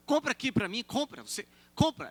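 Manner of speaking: put-on high, loud hawking call of an old vendor; pushy market seller. Market woman pressing the pastor to buy, high and loud. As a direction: shout your wares at a passerby.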